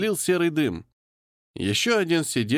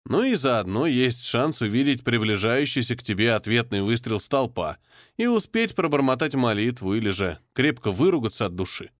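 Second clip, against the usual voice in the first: plain reading voice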